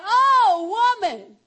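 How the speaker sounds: long, high booming "Oh", falling on "woman"; very loud exclamation. Retold or imitated. Jesus to the woman he ignored, hugely amazed shout. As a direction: belt out the "Oh" in acted amazement.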